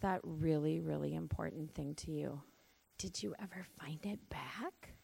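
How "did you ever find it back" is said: whisper. Real, not acted. Teacher whispering a question to the little ones. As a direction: lean in and whisper it gently.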